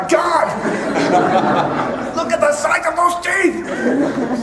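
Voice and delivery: shouting, high, slow and laughing wildlife presenter; excitable Scottish documentary narrator. Pretend. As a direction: ham up a thrilled nature host shouting at a hippo. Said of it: "a big Scottish guy".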